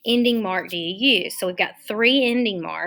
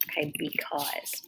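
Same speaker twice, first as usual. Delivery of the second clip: whispered word; 5 semitones lower, 11 dB quieter than usual. Shown as a demonstration. Reads red word while typing.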